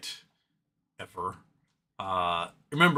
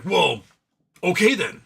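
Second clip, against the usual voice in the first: loud, higher mock surprise; mock surprised whoa; 6 semitones higher, 8 dB louder than usual. Elon's side after losing in court, mock surprised. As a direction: act caught out, loudly. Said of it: "And like".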